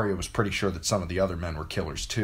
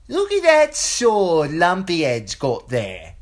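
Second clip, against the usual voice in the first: put-on much higher, louder, slow taunting boy's voice; mocking boy; 12 semitones higher, 9 dB louder, slower than usual. A narrator's reading. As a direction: jeer at her like a bully. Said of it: "Lommie said".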